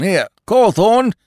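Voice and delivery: calling out. A narrator's reading. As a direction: summon the constable. Calling the constable over.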